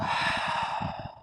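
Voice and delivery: long, released breath out; audible exhale sigh. Shown as a demonstration. Teacher showing the class how to let the breath go, audible sigh. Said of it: "Exhale, let it go".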